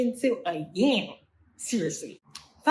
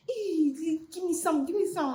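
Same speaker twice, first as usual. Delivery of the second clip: higher wheedling give me some; mock greedy friend, higher; begging friend; 7 semitones higher than usual. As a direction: beg for a share, pitched up.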